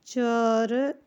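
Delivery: चर said as "char" with a short a; teaching pronunciation. Shown as a demonstration. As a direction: give the ending चर cleanly. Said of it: "चर पढ़ते हैं इसे हम". ("चर" को छोटे अ के साथ साफ़ बोलें)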